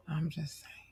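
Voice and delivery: barely-there aside; speechless whisper. Real, not acted. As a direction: whisper it, voice gone from amazement. Speechless after Tanzania surprise.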